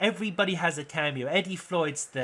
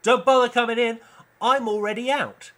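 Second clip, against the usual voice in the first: loud, higher mocking comeback; cocky reply; 8 semitones higher, 7 dB louder than usual. Hidden figure taunts the cops, loud and cocky. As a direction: toss it back loud and smug. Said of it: "a voice comes back and goes".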